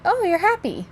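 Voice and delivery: high-pitched mock delight; excited dog's thought. Voicing the excited dogs' reaction.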